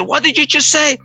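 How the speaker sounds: high-pitched mock yell, little added volume; indignant, high-pitched challenge; retold scream. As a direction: imitate an outraged boss without really screaming. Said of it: "he was screaming so loud".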